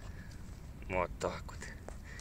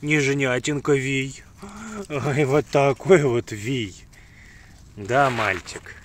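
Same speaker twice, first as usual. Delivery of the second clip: slow, loud doting coo; 4 semitones higher, 6 dB louder, slower than usual. Real, not acted. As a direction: coo over the puppy. Cooing over puppy.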